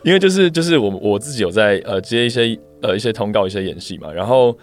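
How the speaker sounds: ordinary talk, nothing marked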